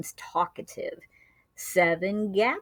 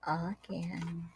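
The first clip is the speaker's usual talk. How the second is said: ordinary delivery, nothing marked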